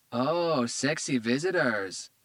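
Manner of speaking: put-on stoned woman flirting in a Spanish accent. A narrator's reading. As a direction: drawl it in a Spanish accent, high and slow. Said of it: "she said with a Spanish accent".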